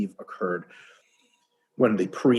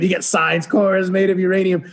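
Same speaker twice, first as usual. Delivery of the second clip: loud, higher, laughing mockery; mocking scorn; 5 semitones higher, 5 dB louder than usual. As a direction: sneer at the stubborn alien and laugh. Mocking the stubborn alien.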